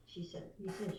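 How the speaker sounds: normal talking voice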